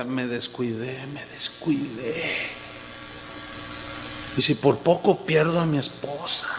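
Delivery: lamento bajo, lento y contenido; llanto imitado. Retold or imitated. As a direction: arrastra las palabras como imitando a un hombre que llora. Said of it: "Y él lloraba y decía". (imitando un lloro apenado, en voz grave)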